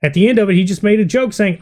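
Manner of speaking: normal talking voice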